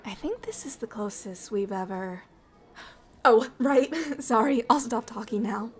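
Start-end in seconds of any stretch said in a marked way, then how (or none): 0.1-2.2 whispering. Acted.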